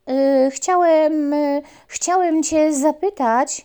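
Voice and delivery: slow, loud stammering puppy; hesitant puppy. Acted. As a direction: stammer out of fear. Puppy scared of mongrel.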